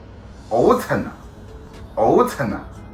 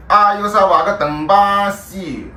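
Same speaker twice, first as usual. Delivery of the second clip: dying words in Shanghainese; 6 semitones higher, 4 dB louder than usual. Shown as a demonstration. Dying words, two takes.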